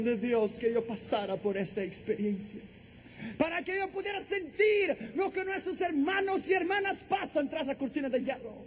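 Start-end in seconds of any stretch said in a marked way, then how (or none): 3.4-8.5 high, pressing preacher's cry; impassioned preaching. Real, not acted. Preacher testifying for persecuted brothers and sisters, impassioned. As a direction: surge high, then ease at the close.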